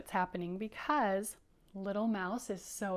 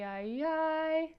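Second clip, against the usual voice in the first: higher, mock-worried "ay, ay"; mock worry; 7 semitones higher, 3 dB louder than usual. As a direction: wince out the "ay"s. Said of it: "covering both of his eyes going".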